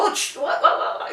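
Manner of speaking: mock excited dog whispering. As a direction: voice the eager dog. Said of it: "They go".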